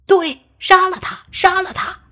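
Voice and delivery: very high, strained outcry; baying for blood. Acted. Villager in a mob demanding death, high-pitched clamour. Mob member calls for killing.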